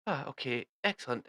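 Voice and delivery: ordinary talk, nothing marked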